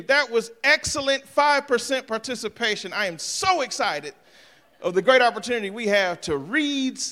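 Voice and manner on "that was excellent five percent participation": normal talking voice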